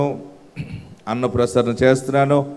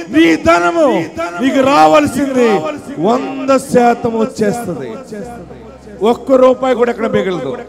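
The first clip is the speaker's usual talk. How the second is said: high, loud shouted sermon; fiery revival preacher shouting deliverance; full-force preaching; 9 semitones higher, 5 dB louder than usual. Acted.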